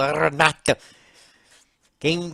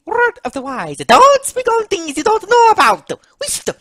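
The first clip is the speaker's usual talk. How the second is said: high, loud preachy character voice; admonishing Mario; 9 dB louder than usual. Acted. Mario warning Luigi not to speak, admonishing. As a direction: lecture him, climbing to a loud high pitch.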